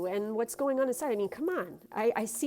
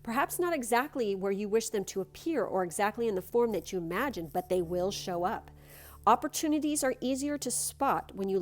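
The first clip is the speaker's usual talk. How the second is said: card reading; 3 dB louder than usual. Acted. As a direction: read it like a gentle blessing. Reads the card text.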